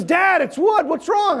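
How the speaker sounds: put-on high shouted innocent kid voice. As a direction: play the puzzled boy who sees no problem. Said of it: "And he goes".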